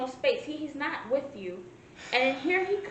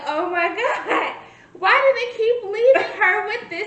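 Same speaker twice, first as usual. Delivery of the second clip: loud, higher-pitched disbelieving outcry; retold exasperation; 7 semitones higher, 9 dB louder than usual. Viewer reliving her reaction to them leaving her with him, loud exasperation. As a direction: replay an appalled outburst at the screen.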